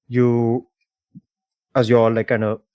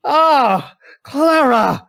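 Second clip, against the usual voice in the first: high, loud mock-delighted cry; startled greeting; 14 semitones higher, 8 dB louder, much slower than usual. Man caught by an old lover, startled cry. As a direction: cry the name out, caught off guard. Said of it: "he goes like".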